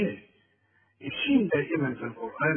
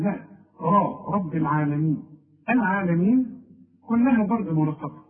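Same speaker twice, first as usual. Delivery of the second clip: usual speaking voice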